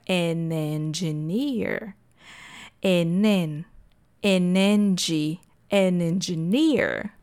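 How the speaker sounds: "an engineer" slowly linked as a-nen-gi-NEER; linked words. Shown as a demonstration. Teacher showing students how to connect "an engineer", slow and linked. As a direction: build "an engineer" piece by piece, n gliding into the vowel. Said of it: "E aí, conectando, fica".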